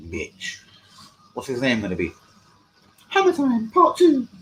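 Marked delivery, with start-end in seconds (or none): 3.1-4.3 high, slow silly falsetto; drawn-out falsetto jeer; mocking voice. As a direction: announce it in a mock squeaky voice. Mimics viewer's imagined new account name.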